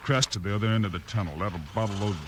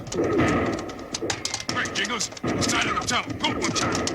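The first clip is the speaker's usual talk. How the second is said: urgent shout; 10 semitones higher, 3 dB louder, much slower than usual. Acted. Bill urging Jingles at tunnel.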